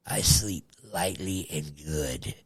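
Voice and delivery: slow, low breathy whisper. Acted. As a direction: murmur it slowly, low. Joking boast, whispered.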